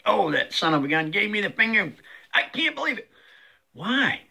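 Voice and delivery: imitating a huffy driver, pitch jumping up at the end; lightly voiced fuming motorist; mock angry driver. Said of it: "And it's like".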